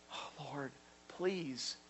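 hushed, drained plea; crying out softly. Acted. Preacher voicing David praying to the Lord, hushed pleading. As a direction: plead almost under your breath. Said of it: "David's crying out".

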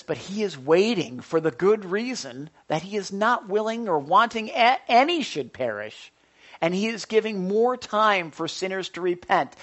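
loud, proclaiming scripture read. Acted. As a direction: declare the verse to the congregation. Reading scripture aloud.

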